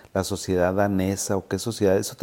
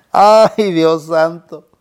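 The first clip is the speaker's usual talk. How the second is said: very high, much louder exclamation of dismay; 8 semitones higher, 11 dB louder, much slower than usual. Retold or imitated. Doctor hears his patient gave the money away, shocked cry. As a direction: throw up your hands in alarm.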